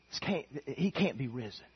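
ordinary talk, nothing marked

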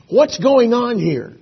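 ordinary talk, nothing marked

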